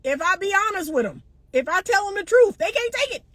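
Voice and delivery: ordinary talk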